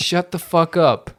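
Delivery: ordinary delivery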